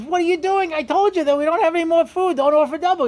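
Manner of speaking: high-pitched exasperated telling-off; retold scolding, pitched well up; scolding wife. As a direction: imitate a wife scolding her husband. Wife scolds husband after dinner.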